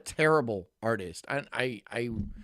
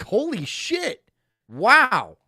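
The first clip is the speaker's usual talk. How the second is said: high, then loud amazed gasp of wow; mock amazed cops; 8 semitones higher, 7 dB louder, much slower than usual. Cops stunned the sketch matches, loud mock amazement. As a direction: ham up the stunned cop.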